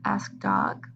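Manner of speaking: ordinary delivery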